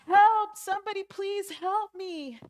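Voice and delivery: high, very loud desperate cry; acted scream for rescue; screaming for help. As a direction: yell up from a cliff edge. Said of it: "he starts screaming".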